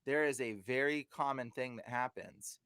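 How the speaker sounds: ordinary talk, nothing marked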